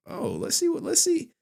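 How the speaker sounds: high, playful snooping voice; high eager voice. Retold or imitated. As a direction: pitch up like a nosy kid. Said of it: "I was like".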